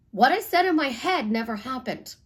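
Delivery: ordinary talk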